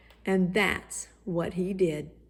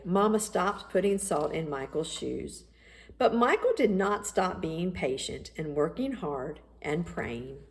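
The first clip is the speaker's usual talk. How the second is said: usual speaking voice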